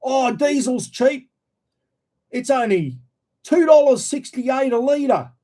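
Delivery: high, slow mock voice; mock-naive sing-song; mocking gullible people. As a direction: ham up the naive buyer praising cheap diesel. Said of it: "People are starting to go".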